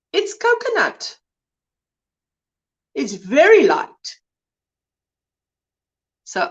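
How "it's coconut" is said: "coconut" said much higher; high-pitched emphatic "coconut"; emphatic repeat. Shown as a demonstration.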